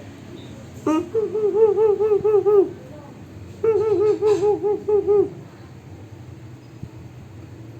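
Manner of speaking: very high squeaky monkey tsz-tsz; monkey chatter. A narrator's reading. Monkeys mocking the peddler, very high chattering. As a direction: chatter back like cheeky monkeys. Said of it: "back at him and said".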